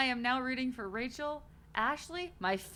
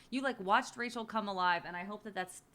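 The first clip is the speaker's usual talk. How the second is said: ordinary delivery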